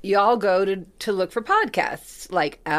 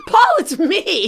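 high, loud, drawn-out mock plea; calling out; 10 semitones higher, 5 dB louder, slower than usual. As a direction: call to a star who should know you. Retells calling out to Paul.

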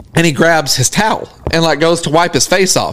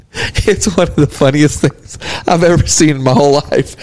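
low, strained voice fighting laughter; holding back laughter; 5 semitones lower than usual. Real, not acted. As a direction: barely get it out through laughter. Breaking up recalling a funny story.

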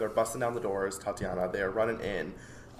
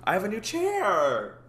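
gushing makeover reveal; 9 semitones higher, 12 dB louder than usual. Real, not acted. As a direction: present it like a big reveal. New chair reveal.

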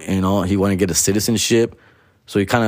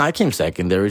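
ordinary talk

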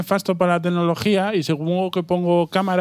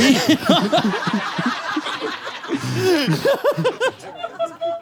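squealing laugh; high laughter; 14 semitones higher than usual. Real, not acted. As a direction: burst out laughing. Laughing at his joke.

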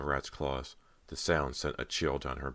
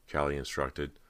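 ordinary delivery, nothing marked

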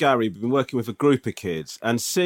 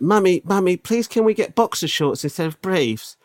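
put-on child begging mum; 6 semitones higher than usual. Child pleading with his mum for boxer shorts. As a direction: beg like a small boy asking Mummy.

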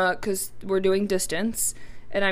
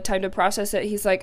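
ordinary talk, nothing marked

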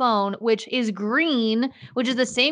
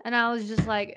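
normal talking voice

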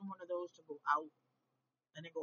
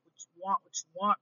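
mock exasperated; 10 dB louder than usual. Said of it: "And I was like".

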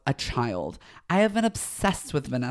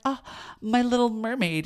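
swooning Eric quote; 8 semitones higher than usual. Retold or imitated. Eric gazing at the mermaid statue, tender and adoring. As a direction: coo it like a smitten prince. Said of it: "he's like".

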